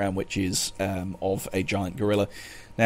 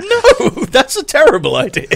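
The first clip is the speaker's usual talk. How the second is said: high, loud laughing outcry; half-laughing shout of protest; mock horrified protest; 11 dB louder than usual. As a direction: yelp it in laughing disbelief. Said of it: "You're like".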